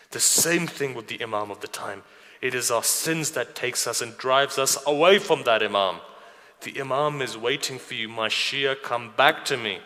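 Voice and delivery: loud, urgent sermon appeal; fervent preaching. Acted. Preacher calling the Shia back to the Imam, fervent.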